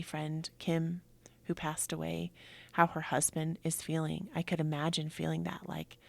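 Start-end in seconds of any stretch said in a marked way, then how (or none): none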